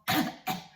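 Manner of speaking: a short, breathy throat cough; demo cough. Shown as a demonstration. Therapist coughing so the child feels the throat. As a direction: cough lightly at the back. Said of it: "or make a cough".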